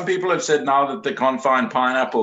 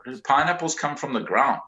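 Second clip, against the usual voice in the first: usual speaking voice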